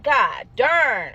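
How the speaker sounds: a startled burst; startled curse. Retold or imitated. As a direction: blurt it out in alarm. Startled exclamation, measures jump.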